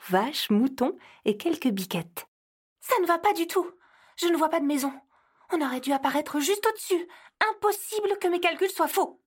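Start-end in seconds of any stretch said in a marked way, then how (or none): 2.8-9.2 high anxious elf voice, fretting over her calculations; worried elf. Acted. Elf copilot frets to Santa about the missing house, high and anxious. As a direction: let the alarm climb as the house fails to appear.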